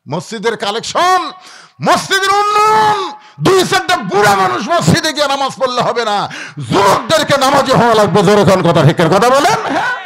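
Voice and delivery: high, loud rallying shout; full-force preaching. Real, not acted. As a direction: thunder it out to rouse the congregation. (গলা ছেড়ে জোরালো ওয়াজ)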